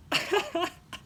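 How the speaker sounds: a sudden high burst of laughter. Real, not acted. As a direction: laugh out, high and bright with glee. Friend laughs at his surprise.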